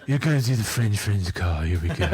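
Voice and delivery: monotone bass, deflated and reluctant; mock a dreary low-voiced host; Kif Kroker. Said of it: "like Kif Kroker from Futurama got a little bit more monotone bass voice".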